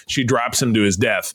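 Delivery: ordinary talk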